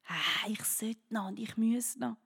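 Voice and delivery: slow worried whisper; anxious whisper. Acted. Listener's inner voice listing duties, slow anxious whisper. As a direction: whisper the nagging to-do thoughts.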